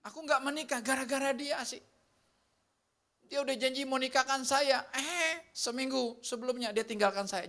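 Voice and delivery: lightly voiced jilted lover; jilted complainer. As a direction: act out someone left at the altar.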